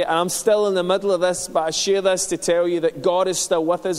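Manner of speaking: fervent pulpit preaching, slowed on key lines. Acted. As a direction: drive the message home to the congregation. Preaching to church.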